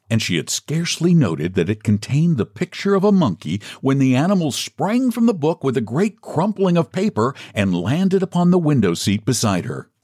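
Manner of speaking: usual speaking voice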